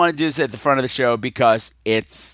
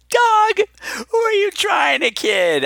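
mock exasperation; slower than usual. Real, not acted. Host exasperated at fake caller.

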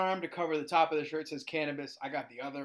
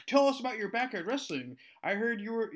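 higher-pitched mimicked schoolkids; pestering students; 7 semitones higher than usual. Students quiz substitute about backyard wrestling, mock kid voice. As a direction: imitate kids pestering their teacher, pitched up. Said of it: "every day, like".

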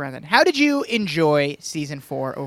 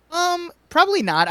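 sheepish; 10 semitones higher, slower than usual. Retold or imitated. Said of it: "my friend was like".

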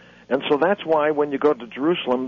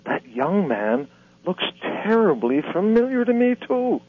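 put-on old man voice; 6 semitones higher than usual. Old man echoes Jesus in joke.

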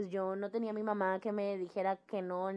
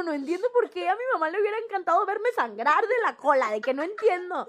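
put-on higher, louder baffled kid; whiny confused child; 7 semitones higher, 6 dB louder than usual. Girl to her family, mock confused whine. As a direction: whine it like a lost ten-year-old.

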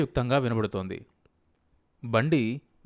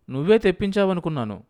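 higher, louder self-defence; defensive retort; 7 semitones higher, 6 dB louder, faster than usual. A narrator's reading. Husband answers wife about the drinks, defensive. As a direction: throw it back at her defensively.

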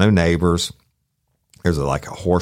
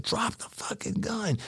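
tense command; 12 dB quieter than usual. Retold or imitated. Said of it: "I said".

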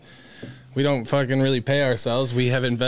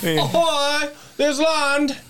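mock pioneer cry; 14 semitones higher, much slower than usual. Friend hams up sailor spotting land, high excited call. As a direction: sing out like a sailor sighting land.

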